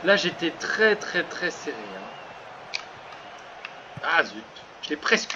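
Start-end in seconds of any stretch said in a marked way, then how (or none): none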